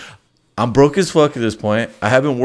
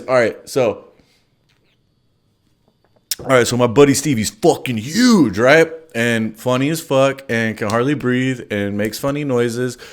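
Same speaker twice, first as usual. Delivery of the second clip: ordinary delivery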